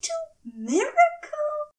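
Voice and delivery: high, affronted question; righteous indignation. Acted. Aziraphale asking Crowley, with righteous indignation. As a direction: ask it scandalized. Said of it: "he asked with righteous indignation".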